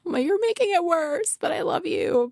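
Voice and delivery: high-pitched, hammed-up tearful whine; mock tearful wail. Mother to daughter holding her hand while both cry, mock tearful. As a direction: wail it in mock distress, easing down.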